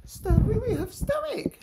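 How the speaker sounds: very high, slow cooing; pet talk. Real, not acted. Presenting ferret.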